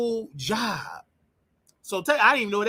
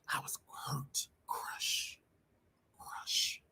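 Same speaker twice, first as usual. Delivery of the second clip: whispered, broken confession; hushed hurt; 7 semitones lower, 16 dB quieter, much slower than usual. Real, not acted. Man recalling his uncle throwing him out, whispered and crushed.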